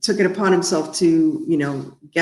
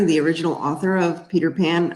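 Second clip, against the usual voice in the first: ordinary delivery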